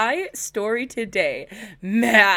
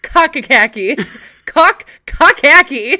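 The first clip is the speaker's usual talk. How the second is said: laughing mispronunciation; 6 semitones higher, 12 dB louder, slower than usual. Real, not acted. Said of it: "That is very wrong".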